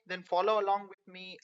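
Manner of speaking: ordinary delivery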